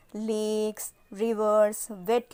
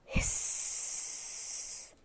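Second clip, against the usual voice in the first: long whispered hiss like a snake; 10 dB quieter than usual. Shown as a demonstration. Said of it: "Let's do it again".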